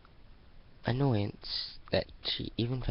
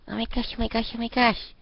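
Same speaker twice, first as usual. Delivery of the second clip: much higher, louder squeal of glee; giddy Sonata; 13 semitones higher, 7 dB louder than usual. Acted. Sonata hugging Sunset, squealing with delight. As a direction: gush like a bubbly fangirl.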